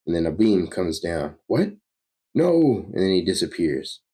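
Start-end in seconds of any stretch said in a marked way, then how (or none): 1.5-2.9 high-pitched startled cry; shocked outcry. Acted. Villain is hit by a sudden beam, shocked. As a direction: jump in pitch with alarm.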